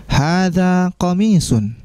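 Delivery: slow, careful Arabic answer for the class to repeat; slow model reading. Shown as a demonstration. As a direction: model it slowly, word by word. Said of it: "Saya akan bacakan dan teman-teman". (dibaca pelan sebagai contoh)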